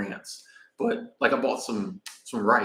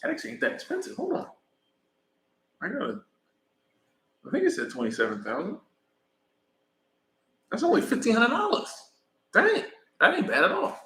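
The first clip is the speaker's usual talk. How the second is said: amazed; 11 semitones higher than usual. Real, not acted. Vlogger checking a scooter price, amazed. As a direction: squeak it out, surprised it's cheap.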